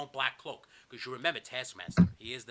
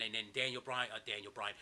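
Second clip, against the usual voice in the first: usual speaking voice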